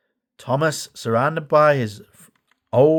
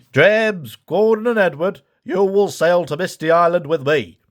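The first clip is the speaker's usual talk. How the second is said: louder, higher commanding voice; Fat Controller; 4 semitones higher, 3 dB louder than usual. A narrator's reading. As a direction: give firm orders to the engines. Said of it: "said the Fat Controller".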